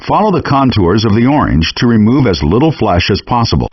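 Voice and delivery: normal talking voice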